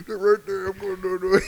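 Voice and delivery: high and loud, psyching himself up; nervous. Retold or imitated. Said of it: "I'm nervous and I'm pacing".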